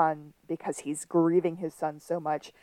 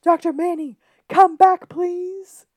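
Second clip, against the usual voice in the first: much higher, much louder desperate scream; 12 semitones higher, 9 dB louder than usual. Retold or imitated. Sean screaming for the psychologist to come back, desperate. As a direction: yell it after someone who is leaving. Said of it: "a nice scream of".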